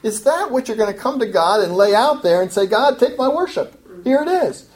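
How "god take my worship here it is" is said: high, showy worshipper offering up worship; mock pious offering. Preacher mimics a hypocrite presenting worship to God, mock pious. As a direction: play the smug hypocrite holding out his worship.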